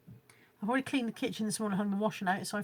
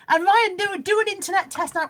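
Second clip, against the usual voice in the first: higher and louder, mock urgent order to himself; self-command; 9 semitones higher, 8 dB louder than usual. Vlogger urging himself to run a speed test, mock urgent. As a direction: bark a comic order to yourself.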